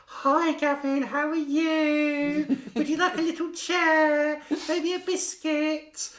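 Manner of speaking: high, loud, slow talking-down voice; patronising greeting. Retold or imitated. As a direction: talk down to him like a child. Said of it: "People automatically being kind, talk down".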